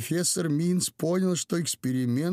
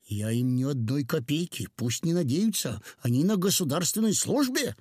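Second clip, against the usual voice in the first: usual speaking voice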